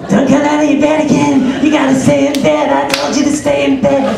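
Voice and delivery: put-on high, loud, unhinged whisper-yell at the kids; crazed Jack Nicholson. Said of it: "You're like Jack Nicholson".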